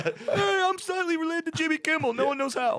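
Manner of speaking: high, strained voice; Super Dave voice. Retold or imitated. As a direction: squeeze it out high and tight.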